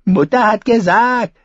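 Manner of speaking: sneering mock of the child; mocking imitation. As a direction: ape the little brother's whine. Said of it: "äffte der König sie nach".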